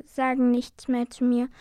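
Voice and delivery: ordinary delivery, nothing marked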